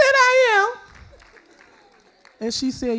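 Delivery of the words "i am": loud, high exclamation; astonished delight. Retold or imitated. Mom hears she is perfect to her daughter, loud astonishment. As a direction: shoot the voice way up in amazement.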